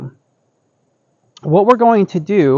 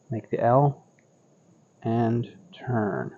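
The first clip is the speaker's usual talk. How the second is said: serene tai chi cues; 4 semitones lower, 9 dB quieter than usual. Acted. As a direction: calmly talk them through each turn. Paced cues during cloud hands.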